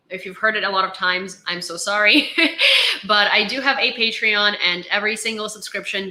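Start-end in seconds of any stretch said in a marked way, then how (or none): 2.3-3.0 a sudden high laugh; high burst of laughter. Real, not acted. Apologising to viewers for plugging Patreon, a high laugh. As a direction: laugh at yourself.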